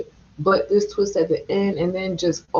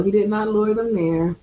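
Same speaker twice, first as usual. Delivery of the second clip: ordinary talk, nothing marked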